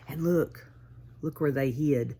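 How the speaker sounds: usual speaking voice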